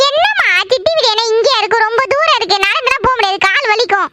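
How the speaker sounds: high-pitched complaining daughter; whining protest. Acted. Daughter objects to walking to aunt's house, whining. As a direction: whine about the errand. Protests being sent on an errand.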